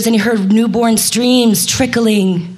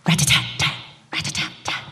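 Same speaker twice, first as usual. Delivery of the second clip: soft whispered rat-a-tat; drumming fading into a whisper; 4 dB quieter, faster than usual. Shown as a demonstration. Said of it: "drumming against a coconut palm".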